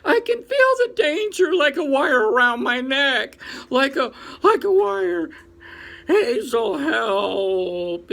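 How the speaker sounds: choking on fear; panicked pleading. Acted. Fiber in panic fit.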